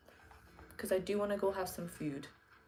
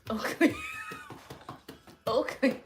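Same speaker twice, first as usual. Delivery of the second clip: calling out; 6 dB louder than usual. Real, not acted. Calling the dog loudly.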